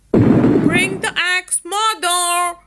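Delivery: a loud call down to mother, fading on the last word; Jack shouting. A narrator's reading. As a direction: yell for the axe while climbing down. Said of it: "shouted Jack".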